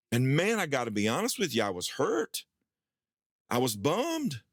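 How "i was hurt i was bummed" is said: ordinary talk, nothing marked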